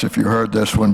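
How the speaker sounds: ordinary talk, nothing marked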